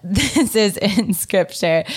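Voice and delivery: ordinary talk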